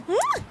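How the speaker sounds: high, quick blown kiss; kiss sound. Pretend. Blowing a kiss to viewer.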